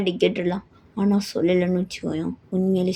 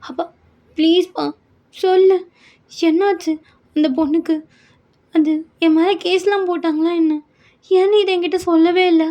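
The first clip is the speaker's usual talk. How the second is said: higher, louder begging daughter; daughter pleading; 9 semitones higher, 4 dB louder than usual. Acted. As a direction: press father for the truth, near tears. Daughter begs father for answer. (உயர்ந்த குரலில் கெஞ்சும் மகள்)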